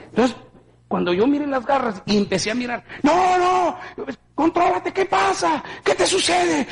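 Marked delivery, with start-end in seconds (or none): 3.0-6.7 shouting in alarm at the morgue; retold yelling. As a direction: yell at someone to get a grip. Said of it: "Y oía un gritedero".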